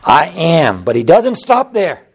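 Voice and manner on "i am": very slow and measured, word by word; slow, deliberate. Acted. Preacher voicing Jesus answering Pilate, slow and deliberate. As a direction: lay each word down slowly like a confession. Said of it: "The Old English expression is".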